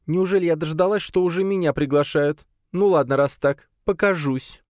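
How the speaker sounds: high, feminine character voice; exclaiming spider. A narrator's reading. Spider is invited to show herself, high exclaiming voice. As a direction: play a pleased little diva, pitched high. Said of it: "воскликнула Лея".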